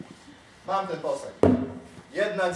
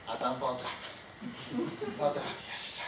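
szept; 8 semitones lower, 15 dB quieter than usual. Retold or imitated. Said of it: "to mówiłaś".

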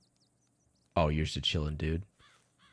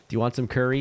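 higher, louder sing-song housewife greeting; mock domestic cheer; mock spouse voice; 6 semitones higher, 5 dB louder than usual. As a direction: play the sweet welcoming partner. Mock spouse chat continues.

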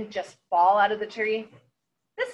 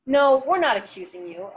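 level, measured denial; evenly; 5 semitones higher, 6 dB louder than usual. A narrator's reading. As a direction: say it flat and even. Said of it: "a boy on the platform said evenly".

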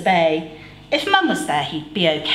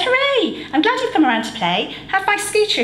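high, fast gleeful cheer; new neighbour boy cheering, higher and fast; excited boy; 13 semitones higher, faster than usual. A narrator's reading. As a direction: burst out happy to see him. Jack voiced, excited boy.

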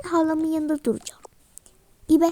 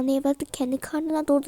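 ordinary talk

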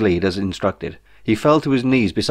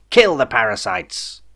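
slow bellow through a tannoy; bellowed over speakers; slower than usual. A narrator's reading. Unseen voice ordering the kill over speakers, slow booming tannoy. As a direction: boom it out over the loudspeakers. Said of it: "a voice bellowed out through wall-mounted speakers".

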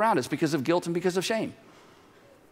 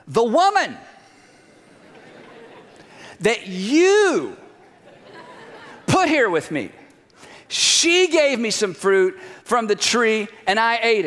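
pointed blame, with long pauses; accusing; 3 semitones higher, slower than usual. Acted. Adam blames God and the woman, accusing. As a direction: point the finger, drawing out the accusation.

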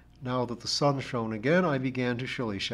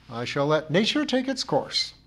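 louder, lifted, haughty refusal; crushing dignity; 5 semitones higher, 5 dB louder than usual. A narrator's reading. Missus Pringle refuses the narrator's help, crushing dignity. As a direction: turn the offer down with crushing dignity. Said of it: "replied the lady with crushing dignity".

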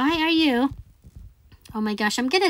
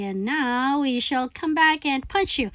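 normal talking voice

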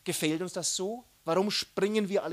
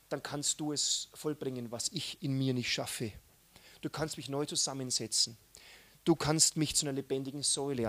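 ordinary talk

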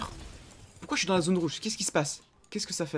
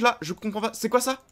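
ordinary delivery

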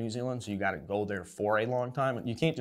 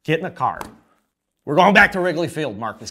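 a loud, higher, pretend command; mock order; 7 semitones higher, 12 dB louder than usual. Host ordering the injured pitcher back to Wrigley, mock loud. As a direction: boss him back to the ballpark.

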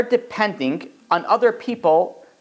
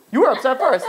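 mock accusation; 7 semitones higher than usual. Acted marital quarrel, accusing back.

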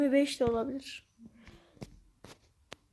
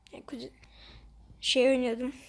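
ordinary delivery

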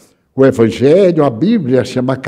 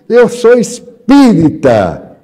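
higher, louder and slow proud declaration; excited confession of faith; proud announcement; 7 semitones higher, 5 dB louder, slower than usual. Retold or imitated. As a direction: announce it with glowing pride. Old man proudly reveals his faith.

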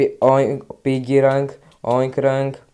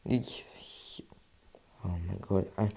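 normal talking voice

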